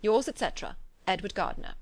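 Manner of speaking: ordinary delivery, nothing marked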